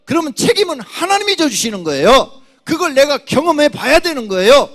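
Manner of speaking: high, loud fervent preaching; climbing to a loud, high pulpit peak; full-force preaching. Acted. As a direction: preach it out to the whole church.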